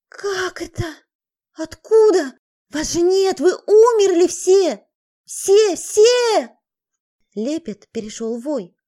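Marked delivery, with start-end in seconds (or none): 0.1-6.6 teeth chattering, babble rising to a howl; from panicked stammer to wailing scream; terrified babble. Acted. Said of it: "Клацая зубами, она залепетала".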